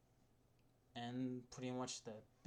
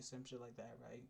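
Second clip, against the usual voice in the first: ordinary delivery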